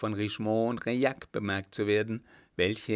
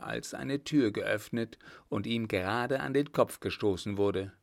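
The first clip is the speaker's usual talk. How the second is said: ordinary delivery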